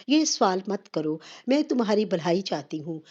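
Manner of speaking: usual speaking voice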